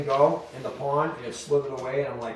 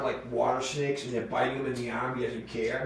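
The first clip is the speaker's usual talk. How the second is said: ordinary talk, nothing marked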